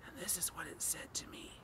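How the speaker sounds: whispered, very soft lead-in; hushed whisper. A narrator's reading. Narrator hushing the audience before the voice speaks, whisper. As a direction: drop to a bare whisper before the reveal.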